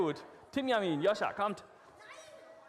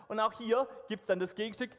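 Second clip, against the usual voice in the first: ordinary delivery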